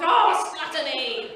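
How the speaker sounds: loud, slow mock ecstasy; mock horny outburst. Woman at nail varnish, mock-ecstatic loud slow cry. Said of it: "we'd get all fucking horny".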